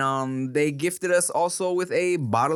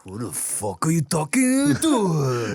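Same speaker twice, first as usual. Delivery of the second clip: tough guy; 2 semitones higher, slower than usual. Pretend. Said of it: "seventies slang".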